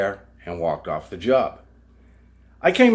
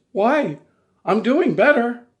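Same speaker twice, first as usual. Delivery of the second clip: mock whine, much higher and louder; whiny protest; 7 dB louder, slower than usual. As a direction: imitate her pleading. Mimicking fired woman's protest.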